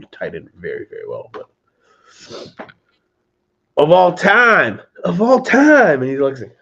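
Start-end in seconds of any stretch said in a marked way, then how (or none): 3.8-6.0 loud, drawn-out hype on the first take; excited emphasis. Real, not acted. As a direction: build the hype, bigger each time. Hyping a favorite movie.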